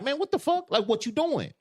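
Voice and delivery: very high, startled mock yell; shocked outburst. Imagining a stranger joining their booth, high shocked outburst. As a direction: snap at the intruder in shock. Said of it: "'Cause instantly you like".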